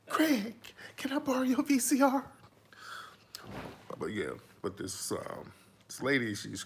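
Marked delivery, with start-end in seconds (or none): 0.1-2.2 high, fast, whiny voice mimicking a begging crackhead; Felicia impression. Mocking Felicia from Friday, high and whiny. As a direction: squeak it out like Felicia begging Craig. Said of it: "Felicia the crackhead?".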